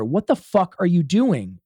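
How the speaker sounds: retold yell, high and loud. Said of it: "even louder".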